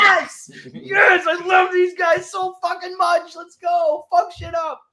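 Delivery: loud, high excited shouting; hyped fan cheering. Retold or imitated. Acting out his excited fan reaction.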